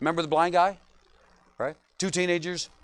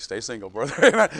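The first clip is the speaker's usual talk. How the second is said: laughing quote; 7 semitones lower, 3 dB louder than usual. Retold or imitated. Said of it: "Ellie's like".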